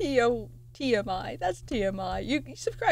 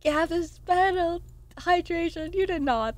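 surprised cry; 7 semitones higher, 5 dB louder than usual. Real, not acted. Gasp at gift.

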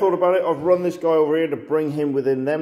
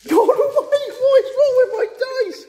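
much higher, louder despairing cry; high exasperated outcry; exasperated wail; 4 dB louder than usual. Real, not acted. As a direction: wail at the useless dice. Despair at bad rolls.

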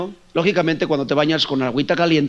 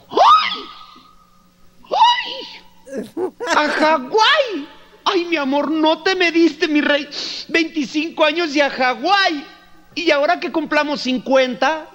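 much higher, shrieking with delight, slow on Hawái; overjoyed wife; 10 semitones higher, slower than usual. Retold or imitated. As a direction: squeal like a thrilled wife. Wife hears of Hawaii trip.

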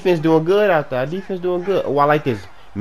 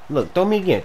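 usual speaking voice

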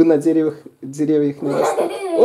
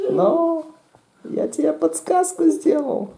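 high playful; 13 semitones higher, slower than usual. Real, not acted. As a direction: lift the voice up playfully. Игра с ребёнком. (высоким игривым голосом)

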